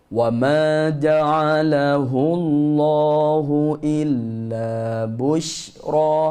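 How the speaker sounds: very slow chanted verse; Quranic recitation. Shown as a demonstration. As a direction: intone it slowly and melodically. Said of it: "teacher recites verse".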